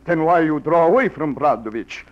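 woo her in a hammed-up Russian accent; seductive fake Russian. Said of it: "like the accent, the beard".

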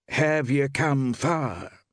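high, slow questioning woman; shopkeeper woman. A narrator's reading. Woman shopkeeper cross-examines a stranger, high and slow.

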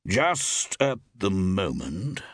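"Just at the moment" very slow, each word weighted; elaborate emphasis. A narrator's reading. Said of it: "began Forbes with elaborate emphasis".

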